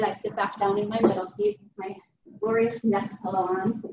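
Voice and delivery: normal talking voice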